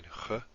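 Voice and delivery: "Ch" as a German throat fricative; German-sounding Ch. Shown as a demonstration. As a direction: say "Ch" as in Bach or Loch. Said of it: "this sort of German sounding".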